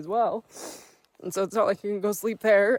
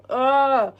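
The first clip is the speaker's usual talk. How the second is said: frustrated yelp; 6 semitones higher, 10 dB louder than usual. Real, not acted. Bursts out in frustration.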